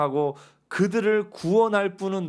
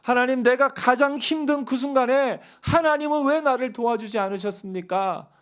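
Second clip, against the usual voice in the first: higher, louder plea of reproach; a hurt believer's raised reproach; complaining to God; 8 semitones higher, 5 dB louder than usual. Retold or imitated. Believer reproaches God for absence, in raised, hurt voice. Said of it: "하나님을 향하여 원망할 때가".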